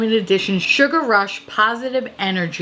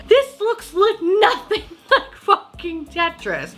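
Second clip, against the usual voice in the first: laughing; 9 semitones higher, slower than usual. Real, not acted. Laughing at the palette.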